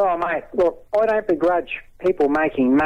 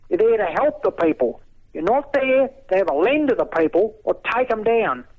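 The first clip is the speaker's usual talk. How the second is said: raised, impassioned pitch; indignant rant; 3 semitones higher than usual. Real, not acted.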